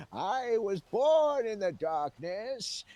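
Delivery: slow, dramatic villain boast; menacing villain line. Retold or imitated. A terrorist in the water boasts to the cop, slow and menacing. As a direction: draw out every word like a movie villain. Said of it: "He said".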